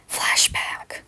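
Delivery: breathy whispered aside; whispered cue. A narrator's reading. Narrator whispering the flashback cue. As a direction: whisper it like a scene transition.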